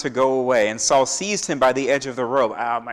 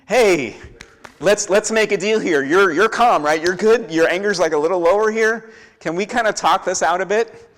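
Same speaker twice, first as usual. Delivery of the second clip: pitched up high, loud and wheedling; nervous bargaining Agag; 6 semitones higher, 6 dB louder than usual. Retold or imitated. Agag pleading with angry Samuel for mercy, high and wheedling. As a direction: coax the angry prophet into a deal, nervously.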